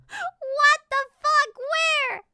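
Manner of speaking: loud, high-pitched disbelief; shocked outburst. Real, not acted. Reacting to a word that sounded like penis, shocked outburst. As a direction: shriek it slowly in real shock.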